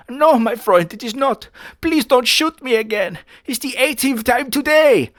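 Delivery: hammed-up pleading Hitler voice; high mock pleading. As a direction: whine like a weary cartoon villain.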